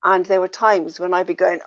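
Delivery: ordinary talk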